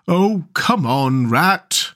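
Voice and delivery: slow, drawn-out impatience; impatient. A narrator's reading. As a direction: drag the words out, fed up with waiting. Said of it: "waited impatiently".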